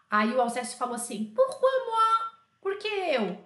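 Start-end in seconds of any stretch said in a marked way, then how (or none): 1.3-3.4 put-on a kid wailing it's unfair; mock outraged schoolboy; indignant high whine. Alceste protesting, indignant.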